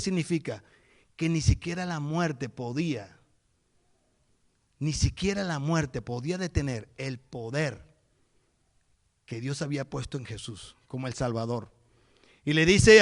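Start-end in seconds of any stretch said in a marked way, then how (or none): none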